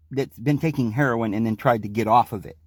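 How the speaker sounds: normal talking voice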